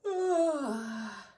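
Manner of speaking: loud high drawn-out yawn sound; acted yawn. Shown as a demonstration. Teacher acting out a yawn for the word yawned.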